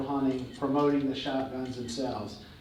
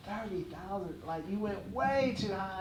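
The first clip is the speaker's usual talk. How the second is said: inner panic; 5 semitones higher, 9 dB quieter than usual. Retold or imitated. Inner panic at wife's price.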